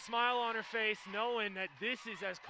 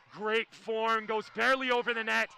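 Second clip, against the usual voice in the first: louder, rising rally call; live play-by-play; 4 semitones higher, 9 dB louder than usual. Real, not acted. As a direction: follow the ball with mounting urgency.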